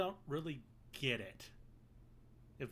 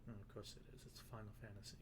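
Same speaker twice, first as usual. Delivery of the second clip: normal talking voice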